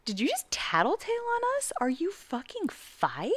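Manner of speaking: hammed-up incredulous questioning; incredulous retort. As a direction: imitate a friend scoffing in disbelief. Retold incredulous retort to a tattler.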